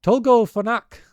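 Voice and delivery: high, shrill, loud shout; crying aloud. A narrator's reading. Tiny islander shouting to the others before the arrows, loud cry. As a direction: call it out like a shrill war cry. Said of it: "I heard one of them cry aloud".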